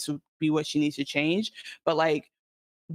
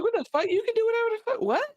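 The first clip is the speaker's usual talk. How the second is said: high, bewildered mock voice; imitating confused Rob; faster than usual. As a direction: squeak it out in total confusion. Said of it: "he's like".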